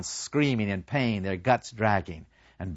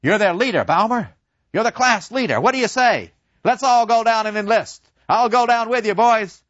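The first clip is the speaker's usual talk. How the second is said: much higher, louder urging; rousing professor; 11 semitones higher, 6 dB louder than usual. Retold or imitated. Professor urging Baumer to lead the class, loud and high. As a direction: whip the class into enlisting. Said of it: "Professor Kantorek came in and said".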